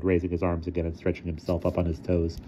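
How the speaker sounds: ordinary delivery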